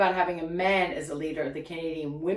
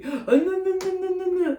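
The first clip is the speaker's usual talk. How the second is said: mock gasp; 9 semitones higher, 3 dB louder than usual. Mocking husband's gasp.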